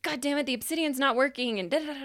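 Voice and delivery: freaking out. Retold or imitated. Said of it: "freaking out".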